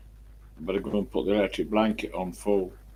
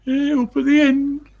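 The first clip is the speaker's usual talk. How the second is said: high, loud Brummie lilt; Birmingham accent; 7 dB louder than usual. Shown as a demonstration. Man showing how Birmingham voices rise, Birmingham accent. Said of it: "The voice rises".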